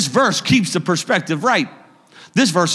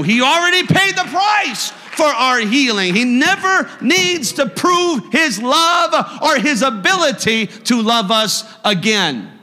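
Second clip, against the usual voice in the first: shouting the promise from the pulpit; preaching shout; 7 semitones higher than usual. Real, not acted. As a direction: lift it up to full force. Preacher rising to a climax.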